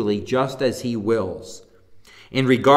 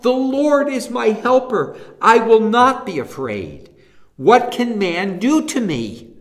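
bold affirmation; 7 semitones higher than usual. Shown as a demonstration. Preacher leading the congregation to affirm it boldly. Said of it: "Can you say that?".

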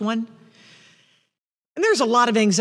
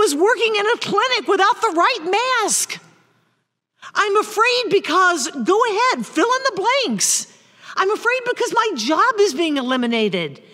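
urgent voice; 9 semitones higher, 3 dB louder than usual. Acted. Voicing the fearful answers, high.

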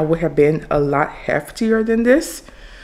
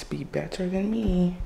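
softer, earnest plea; sincere; 9 dB quieter than usual. Real, not acted. To her viewers, in all seriousness, a soft sincere plea. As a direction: drop the joke and mean it quietly. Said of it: "In all seriousness".

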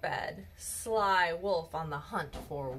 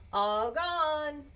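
trumpeted; 6 semitones higher than usual. Acted. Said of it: "I trumpet".

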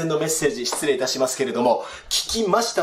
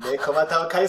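normal talking voice

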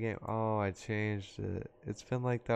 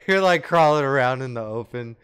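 laughing; 4 semitones higher, 10 dB louder than usual. Real, not acted. Teasing teammate while laughing.

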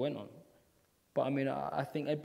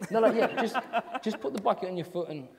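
laughing director voice; 10 semitones higher, 4 dB louder than usual. Retold or imitated. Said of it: "Should I have this bucket".

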